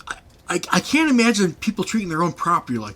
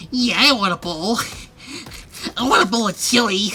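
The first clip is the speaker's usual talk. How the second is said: put-on higher, eager kid voice; kid's eager reply; 7 semitones higher than usual. Kid grinning at the bowling desk, higher and eager. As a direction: play the kid asking for chili. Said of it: "And he goes".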